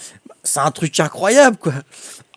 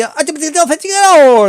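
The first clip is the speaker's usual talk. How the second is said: high, loud, rushed Italian-style exclamation; playing the Italian cashier, voice jumps high; 10 semitones higher, 6 dB louder than usual. Impersonating the cashier talking to him in a high voice. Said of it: "elle dit".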